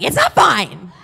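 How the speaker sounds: loud, high-pitched mock panic; exasperated outburst. Panicked retort to reassuring text.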